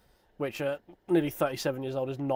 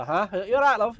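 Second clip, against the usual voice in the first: high, loud Northern English echo; northern accent echoed; 9 semitones higher, 10 dB louder than usual. Retold or imitated. Groggy echo of the woman's Northern accent. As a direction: repeat it back in Northern English. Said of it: "had to deal with that accent".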